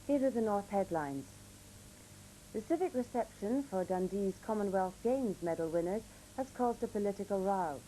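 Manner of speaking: ordinary talk, nothing marked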